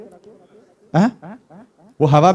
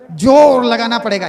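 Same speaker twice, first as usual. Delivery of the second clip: "जोर लगाना पड़ेगा" higher, with a punched "जोर"; forceful exhortation; 5 semitones higher, 4 dB louder than usual. Shown as a demonstration. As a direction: drive "जोर लगाना पड़ेगा" home at a raised pitch. Preacher repeats it with force. ("जोर लगाना पड़ेगा" ऊँचे सुर में, पूरे ज़ोर से)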